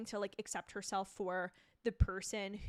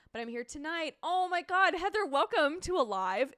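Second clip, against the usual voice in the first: normal talking voice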